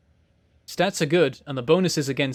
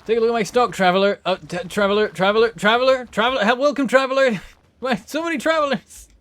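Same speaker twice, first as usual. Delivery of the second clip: higher, louder hammed-up merchant greeting; mock shopkeeper; 5 semitones higher, 4 dB louder than usual. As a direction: play with the word traveler like a sing-song vendor. Playing shopkeeper, repeating traveler.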